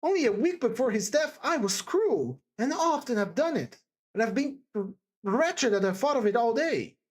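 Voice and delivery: distressed, earnest character voice; agitated Sonia, remorseful. Acted. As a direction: blame yourself in emphatic bursts. Sonia reproaching herself.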